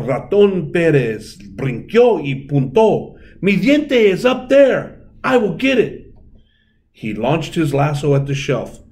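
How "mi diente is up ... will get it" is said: high, loud determined cry; eager mouse. A narrator's reading. Mouse spotting his tooth on the shelf, eager. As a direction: spot it and go for it.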